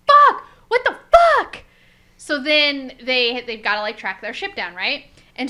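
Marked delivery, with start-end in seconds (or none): 0.1-1.6 very high, loud mock scream; Boimler's outraged yell. Boimler seeing the ship taken off, outraged yell. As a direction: shriek the curse in acted dismay.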